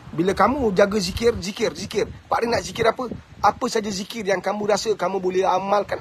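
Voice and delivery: usual speaking voice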